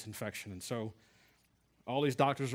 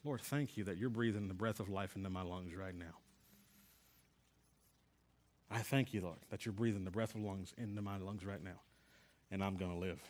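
hushed prayer; 4 semitones lower, 6 dB quieter than usual. Retold or imitated. And I remember saying.

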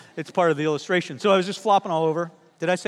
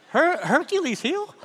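high, slow, laughing repeat of "Hercules heel"; laughing disbelief; 9 semitones higher, slower than usual. Real, not acted. Pastor laughing at the minister's word "Hercules heel". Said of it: "Achilles heel".

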